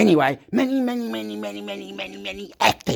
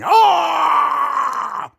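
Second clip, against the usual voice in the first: a sudden high, loud ah of frustration; frustrated yell; 10 semitones higher, 6 dB louder than usual. Real, not acted. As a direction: let out a short exasperated cry. Frustrated outburst.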